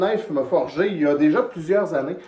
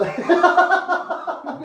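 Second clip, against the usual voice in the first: high bursting laugh; burst of laughter; 14 semitones higher, 3 dB louder than usual. Real, not acted. Laughing at dad's pose.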